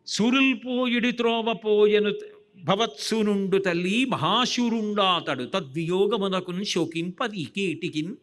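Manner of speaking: drawn-out, declaimed Telugu padyam; verse recitation. Acted. Preacher reciting an old verse to listeners, verse recitation. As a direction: recite the verse slowly with sustained syllables.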